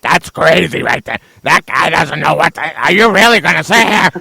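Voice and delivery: a hint of the host he can't stand, mocking his delivery; Stu Gotts voice. Shown as a demonstration. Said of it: "somebody that talks like this".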